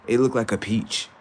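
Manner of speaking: normal talking voice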